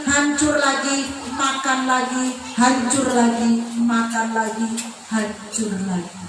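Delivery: low, dying-away refrain; sinking repetition. Real, not acted. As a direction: let the repeated phrases sink and fade away. Endless cycle of torment, voice sinking.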